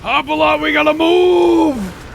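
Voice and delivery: urgent yell. Real, not acted. Yelling urgently to move.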